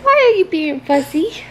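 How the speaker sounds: ordinary delivery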